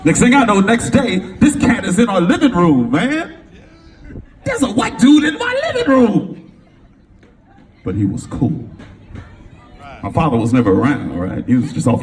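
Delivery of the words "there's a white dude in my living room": high, laughing exclamation; high laughing shout of disbelief; amazed shout. Real, not acted.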